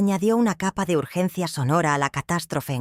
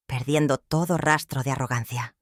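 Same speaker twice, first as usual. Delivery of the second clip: ordinary delivery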